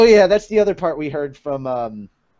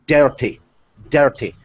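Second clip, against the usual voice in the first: trigger words dirty, dirty in a light Scottish accent; a hint of Scottish Craig Ferguson accent on dirty; 5 dB louder than usual. Shown as a demonstration. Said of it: "with that Scottish accent he has".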